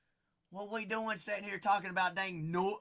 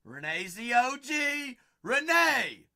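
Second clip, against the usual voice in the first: loud, higher-pitched shout-out; calling out; 5 semitones higher, 7 dB louder than usual. Real, not acted. Host hailing Renee the O G, calling out. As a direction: hype up the name, loud and high.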